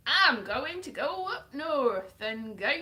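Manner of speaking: put-on loud, slow, faltering Northern English accent; attempted Northern accent. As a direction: badly fake a drawn-out Northern accent. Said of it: "see, I've lost it now".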